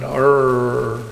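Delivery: imitated dog growl, drawn out on "rrr"; angry dog growl. Colleague answering the dog question, imitated growl. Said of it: "what sound does an angry dog make".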